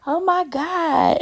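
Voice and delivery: mock-amazed guest reaction; imitated awe. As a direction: draw it out like an impressed visitor. Acting out visitors admiring the house.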